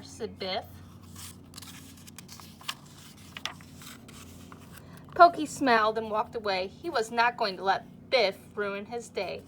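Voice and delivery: lively storybook reading to a class; reading to children. A narrator's reading. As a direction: read it like story time for little kids. Reads picture book to class.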